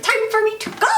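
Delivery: very high comic getaway cry; hammed-up fleeing heroine; mock panic. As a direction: squeal it, stretching the last word. Alex voiced, fleeing the killers.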